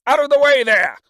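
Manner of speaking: high, loud bawl. A narrator's reading. Coachman bawls at the crowd blocking the lane. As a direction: bellow at the crowd to clear. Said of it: "bawled a coachman".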